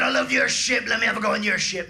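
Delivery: loud, hearty pirate demand. Retold or imitated. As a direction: boom it out like a pirate. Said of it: "He said".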